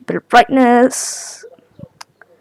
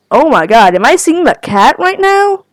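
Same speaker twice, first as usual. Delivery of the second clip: ordinary talk, nothing marked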